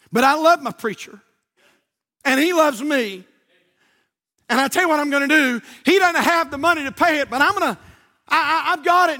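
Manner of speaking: fervent preaching. Acted. Brandon pleads for his preacher, fervent and loud. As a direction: pour love into the plea.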